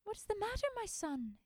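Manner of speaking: mother asking her tearful son; gently asking mother. Acted. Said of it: "his mother asked".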